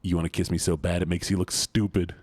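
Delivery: normal talking voice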